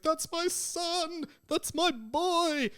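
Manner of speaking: high, drawn-out mock wail; Amos Diggory again. Podcaster recalling the mocked Harry Potter wail, high. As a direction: repeat the famous wail. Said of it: "that bit where he's like".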